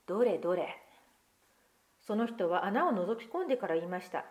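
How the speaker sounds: put-on lively picture-book reading to children; story narration. Pastor reading a picture book to children, lively storytelling. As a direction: read the tale aloud to the congregation, expressive.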